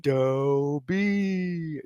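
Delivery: long, drawn-out announcer call. Acted. Friends ask for his game-call tone, drawn out. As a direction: call it like a big play. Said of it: "anything said in that tone".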